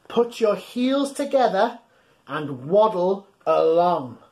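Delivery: plain reading voice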